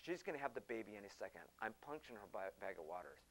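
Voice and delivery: a hint of a dismissive doctor; low doctor voice. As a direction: mutter it like a doctor with his mind made up. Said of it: "He's going like".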